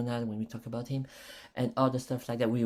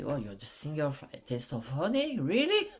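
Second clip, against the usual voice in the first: amazed fan. Retold or imitated. Retells meeting an admired singer.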